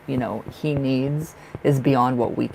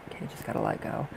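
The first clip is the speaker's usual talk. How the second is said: whispered to self; 3 semitones lower, 10 dB quieter, faster than usual. Real, not acted. Calming herself down.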